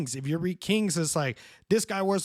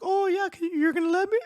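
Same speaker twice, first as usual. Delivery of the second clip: very high-pitched, eager child imitation; excited little girl; 4 dB louder than usual. Little girl allowed to say bad words, mock excited. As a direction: squeak it like a kid handed forbidden permission. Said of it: "got so excited".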